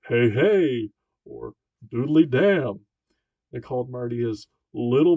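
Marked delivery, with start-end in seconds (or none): 0.1-0.9 loud booming hey hey; bellowing big pal. A narrator's reading. Nervous gym teacher booming at Marty. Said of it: "he spoke in his bellowing big pal voice". 1.9-2.9 loud slow booming doodly damn; bellowing big pal. A narrator's reading. Nervous gym teacher booming at Marty. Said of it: "he spoke in his bellowing big pal voice".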